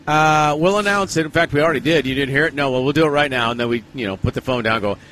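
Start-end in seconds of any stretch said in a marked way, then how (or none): none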